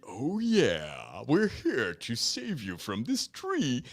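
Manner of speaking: low, slow macho fireman voice; mock macho firemen. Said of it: "this first moment".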